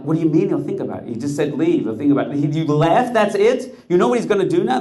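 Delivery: high, loud disbelief rising to a cry; panicked incredulous outburst; alarmed disbelief. Acted. Prisoners alarmed that the Rebbe just left, high and loud.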